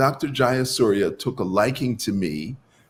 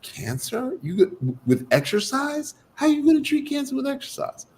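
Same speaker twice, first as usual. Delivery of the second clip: high-pitched, incredulous questioning; stumbling into a high, baffled question; baffled disbelief; 14 semitones higher, faster than usual. Retold or imitated. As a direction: act out a mind reeling in disbelief. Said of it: "my brain is spinning".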